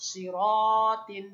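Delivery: সিরাতিন stretched slowly, loud; teacher modelling সিরাতিন slowly; slow model word. Shown as a demonstration. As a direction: hold each syllable of সিরাতিন. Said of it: "কি উচ্চারণ?".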